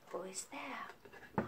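slow hushed whisper. Acted. Teacher whispering into the teddy box. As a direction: ask it softly so nobody wakes.

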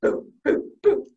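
short, separate little puffs; Baby Ghost boos. Retold or imitated. Baby Ghost joins the haunting, short boos. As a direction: puff out tiny ghost boos. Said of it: "Baby Ghost would go".

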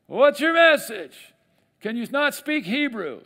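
high loud slow call across a distance; calling back. Retold or imitated. Leaders calling back to the envoy, high slow shout.

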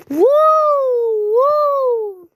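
high, loud whoop; a high, loud triumphant whoop; joyful whoop. Real, not acted.

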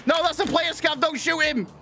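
high, panicked apology; high frantic voice. Pretend. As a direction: call off the fire. Good side apologizing, high.

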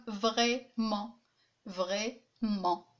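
"vraiment" split as vrai, pause, ment; each syllable of "vraiment" on its own beat; two separate syllables. Shown as a demonstration. Teacher counting two syllables, broken apart evenly. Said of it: "deux syllabes".

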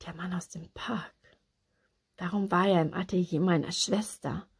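softly whispered, to oneself; hushed inner thought. A narrator's reading. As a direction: think it aloud under your breath.

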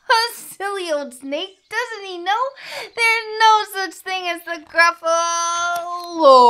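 high, loud sing-song crowing; gleeful mocking. A narrator's reading. Mouse jeers at silly old snake, high and gleeful. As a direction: stretch it into a triumphant, chanted taunt.

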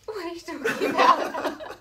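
mock confusion. Wife retelling her puzzled reaction, high and loud. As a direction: laugh out a baffled question. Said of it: "Sometimes I'd be like".

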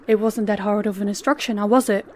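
ordinary delivery

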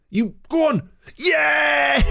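high, climbing celebration shout; jubilant, high-pitched cheer; elated goal shout. Real, not acted. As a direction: yell as your team scores. Cheering own goal live.

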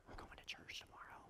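put-on hushed, breathy whisper behind the boy; wife whispering over the son's shoulder to nudge her husband; whispered aside.

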